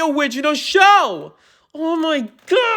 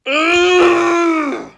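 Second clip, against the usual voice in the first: usual speaking voice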